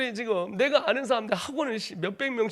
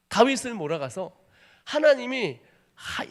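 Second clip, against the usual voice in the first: normal talking voice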